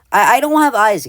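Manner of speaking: high, loud insistence to the viewers; exasperated protest. Acted. Artist answering people's comments about eyes, exasperated protest.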